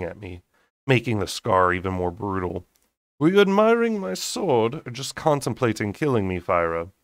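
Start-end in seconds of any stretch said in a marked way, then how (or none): 3.2-6.9 smirking taunt. A narrator's reading. Lucian teasing Fyra across the table, smirking and mocking. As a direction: tease her like a smug predator.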